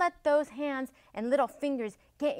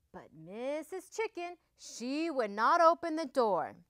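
usual speaking voice

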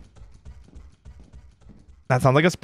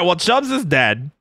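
ordinary talk

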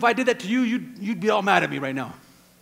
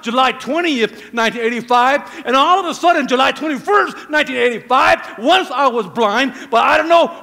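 loud, emphatic testimony; raised voice, driving the story home to the congregation; fervent preacher testifying at volume; full-force preaching; 4 semitones higher, 8 dB louder than usual. Acted.

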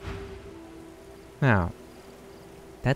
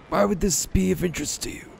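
higher, quicker hero voice; character voice; 7 semitones higher, faster than usual. Pretend. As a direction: play the hero asking. Voicing player character's line.

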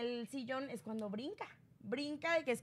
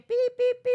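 high loud bleeping pi pi pi; censor bleep; 11 semitones higher, 12 dB louder, faster than usual. Shown as a demonstration. Mimicking the TV bleep over the insults, loud censor beeps.

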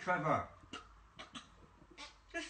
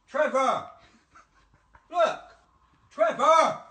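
louder exasperated yell; man yelling at the speaker to wake it; shouting; 8 dB louder than usual. Real, not acted. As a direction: call it out hard.

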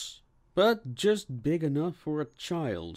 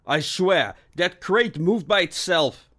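raised, higher protest; defensive; 6 semitones higher, 6 dB louder than usual. A narrator's reading. Officer to his inspector about the crate, defensive. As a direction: insist it wasn't your doing. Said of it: "Bixby responded defensively".

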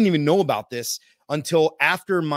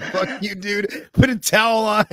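raised-voice jokey telling-off; retold rebuke; 5 semitones higher, 4 dB louder than usual. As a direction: scold a buddy, half laughing.

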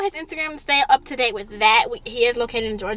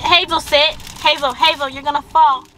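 ordinary talk

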